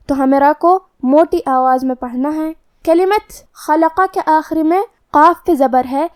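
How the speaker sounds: usual speaking voice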